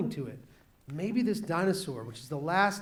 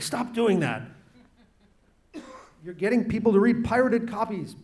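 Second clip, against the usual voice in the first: mock scolding agent; 5 semitones higher, 4 dB louder than usual. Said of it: "His agent's like".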